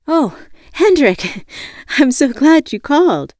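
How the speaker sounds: high, loud, gushing greeting; relieved gladness. A narrator's reading. Woman answers friend's phone call, gushing with relief. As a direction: burst out with a laugh of relief. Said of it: "I answered in a hurry, so glad".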